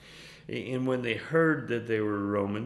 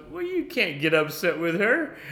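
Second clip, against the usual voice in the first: wife; 8 semitones higher, 5 dB louder than usual. Retold or imitated. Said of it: "And my wife goes".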